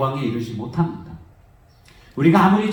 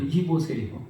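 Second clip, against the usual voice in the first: a cold quiet "이보세요."; soft dismissive; 6 dB quieter than usual. Retold or imitated. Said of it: "그러는 거예요".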